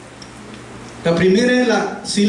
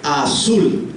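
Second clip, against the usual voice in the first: "Azul" with the weight on the final syllable "zul"; stress on SUL; 4 semitones lower than usual. Shown as a demonstration. Teacher correcting own slide error, "Azul" stressed on its last syllable. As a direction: hit the second syllable of "Azul". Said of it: "La sílaba tónica es sul".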